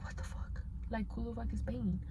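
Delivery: retold under-the-breath "what the hell" reaction; whispered disbelief. Reacting to someone banging on the door, whispered disbelief. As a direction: whisper it, puzzled and startled.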